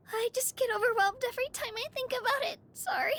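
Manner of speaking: overwhelmed, fighting back tears; teary laugh through the sorrys; choking up. Acted. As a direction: voice cracking into tears, rushed. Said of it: "I shouldn't have started crying".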